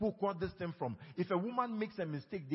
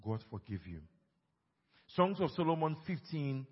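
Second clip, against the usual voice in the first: ordinary talk, nothing marked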